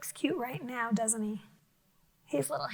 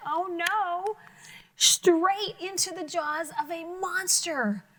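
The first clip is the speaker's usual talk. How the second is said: higher, excited cry of alarm; mock alarm; 6 semitones higher, slower than usual. Teacher crying out as the toad meets the monster. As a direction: gasp it out for the children.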